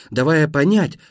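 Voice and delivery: plain reading voice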